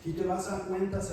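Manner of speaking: usual speaking voice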